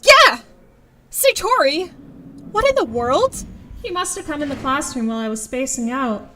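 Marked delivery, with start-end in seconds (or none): 0.0-0.4 short, loud, high shriek; startled yelp. Acted. Startled by friend's boo. 1.1-3.4 high-pitched indignant surprise; startled scolding. Acted.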